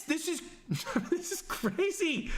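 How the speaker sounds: high, slow, laughing through the words; laughing disbelief. Real, not acted. Streamer reacting to no-accents rule, laughing disbelief. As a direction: laugh it off as absurd.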